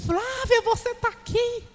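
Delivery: lightly voiced surprised church sister; imitating the sister. Church sister greeting Flávia after service, lightly voiced surprise.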